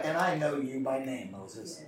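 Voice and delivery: God speaking tenderly to a friend; soft, intimate voice of God naming Moses. Acted.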